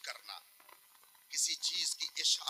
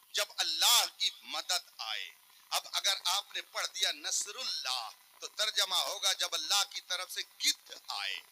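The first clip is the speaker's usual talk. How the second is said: ordinary talk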